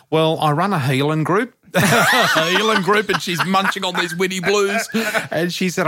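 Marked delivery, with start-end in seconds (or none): 1.7-4.9 laughing, much higher and slow; laughing echo. Real, not acted. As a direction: repeat it with disbelieving laughter. Amused echo of her words.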